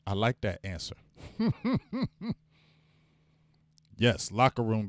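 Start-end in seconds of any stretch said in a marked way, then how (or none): 1.2-2.3 high chuckle in short pulses; light, high, pulsing laugh; real giggle. Real, not acted. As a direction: giggle to yourself at an answer. Chuckles at a viewer's answer.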